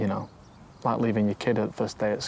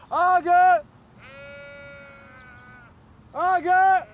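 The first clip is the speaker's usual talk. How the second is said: loud, much higher call across the field; shouting across a field; 6 dB louder, much slower than usual. Real, not acted. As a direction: yell out to call the sheep home. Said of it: "I'm gonna shout my pet sheep".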